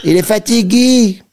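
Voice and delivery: high, slow put-on Russian accent; russian accent in french. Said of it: "si je lui parle avec un accent, euh, russe".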